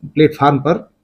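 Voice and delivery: normal talking voice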